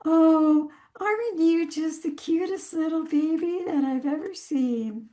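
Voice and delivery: put-on high, sing-song cooing to a baby; baby talk. Shown as a demonstration. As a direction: gush over an infant in a high voice. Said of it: "think about how you talk to a baby".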